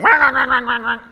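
laughing mock duck quacking; high laughing wah wah of ducks; duck quacks. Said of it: "ducks going".

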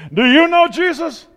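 loud, high mock-earnest question; hammed-up zealous friend asking the waitress. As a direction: blurt it to the waitress. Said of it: "turns to the waitress and say".

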